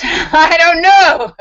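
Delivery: slow, high and loud through a laugh; cracking up while delivering the stoner's line; laughing stoner punchline. Acted.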